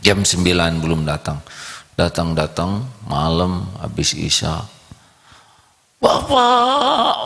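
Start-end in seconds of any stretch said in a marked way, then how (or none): 6.0-7.3 put-on high calling child voice; child calling father. Boy runs to his father calling, high child voice. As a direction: call out to dad.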